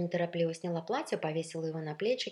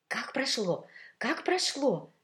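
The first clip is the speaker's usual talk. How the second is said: high, excited clamour; bubbling, raised-voice questioning; eager, overlapping questions; 8 semitones higher, 5 dB louder, slower than usual. A narrator's reading. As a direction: pounce with curiosity, like several gossips at once. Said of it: "Перебивая друг друга".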